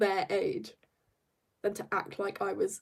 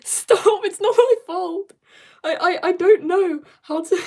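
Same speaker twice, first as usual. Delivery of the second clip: high, loud laughing wail; much higher, louder playful outburst; flustered protest; 9 semitones higher, 10 dB louder than usual. Real, not acted. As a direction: protest loudly, laughing at your own fumble. Flustered at own mispronouncing, laughing protest.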